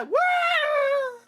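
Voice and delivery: loud, very high whoop; high battle-cheer scream; long, wild whoop; charging whoop. Acted. Troops cheering as they charge.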